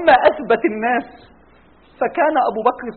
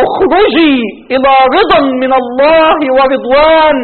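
slow, drawn-out solemn summons; slow solemn call; 5 dB louder, slower than usual. Acted. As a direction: call the soul out slowly and gravely. ملك الموت يخرج الروح. (نداء مهيب بطيء ممدود)

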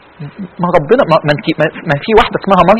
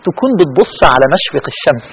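ordinary delivery

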